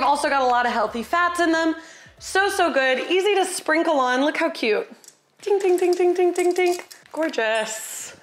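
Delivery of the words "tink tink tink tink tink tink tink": high, repeated little "tink" sounds; imitated tinkling. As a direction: sprinkle out tiny tinkles on a high note. Imitates seeds sprinkling onto salad.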